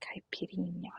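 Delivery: "Caipirinha" said softly, stress on "rin"; testing the stress. Shown as a demonstration. Streamer checking the accent to herself, soft "Caipirinha" stressed on "rin". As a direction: quietly try "Caipirinha" with the last i stressed. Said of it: "Is the stressed syllable on the last I?".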